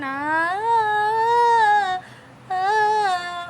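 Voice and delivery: high, drawn-out mock wail; imitated crying. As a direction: wail like a melodramatic film scene. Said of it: "like, crying".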